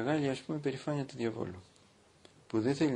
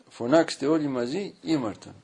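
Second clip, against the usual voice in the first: calling out; 3 semitones higher, 7 dB louder than usual. Retold or imitated. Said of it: "Και λέει ο Παπούλης".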